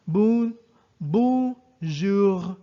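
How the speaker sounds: "bonjour" ending in a gargled R from the throat; teacher shows the throaty R of "bonjour"; gargled French R. Shown as a demonstration. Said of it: "the vibration for the R is on the throat".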